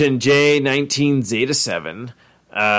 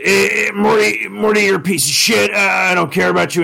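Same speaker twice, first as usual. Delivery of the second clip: Rick impression; 7 semitones higher than usual. Podcaster showing how normal Rick insults Morty, high and shouting. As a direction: sneer it out with a belch-ready rasp. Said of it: "a normal Rick is like".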